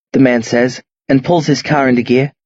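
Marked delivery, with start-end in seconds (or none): none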